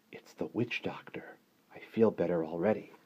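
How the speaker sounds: put-on soft, whispery child in bed; hushed relief. A narrator's reading.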